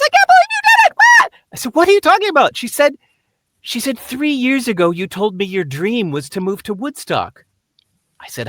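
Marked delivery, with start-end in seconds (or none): 0.0-1.3 very loud, shrieking-high amazement; screaming disbelief. Retold or imitated. Friend hears about the Woodstock move, shrieking disbelief. 1.8-2.6 high, loud, baffled question; loud bewilderment. Retold or imitated. Man asking his screaming friend to explain, loud bafflement. 4.0-7.4 high-pitched, urgent retelling; excited explaining. Retold or imitated. Friend reminding him of his old dream, high excitement.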